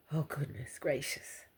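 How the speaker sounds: choked-up breathy whisper, near tears; overcome with emotion. Real, not acted. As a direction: let the feeling break through as a whisper. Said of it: "you know, got emotional".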